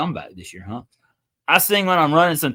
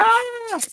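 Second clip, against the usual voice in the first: startled yelp. Real, not acted. Startled while turning camera.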